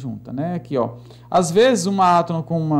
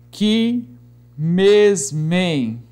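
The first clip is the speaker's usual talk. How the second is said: isolated, lengthened syllables; deliberate metrical reading; syllable-by-syllable scansion; much slower than usual. Shown as a demonstration. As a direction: set each beat apart with pauses. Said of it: "vamos escandear aqui alguns versos".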